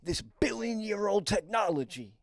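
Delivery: excited stranger on the bus insists on alien tech; coke dealer talking aliens. Retold or imitated. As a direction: sell it like a wild conspiracy revelation. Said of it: "he's like".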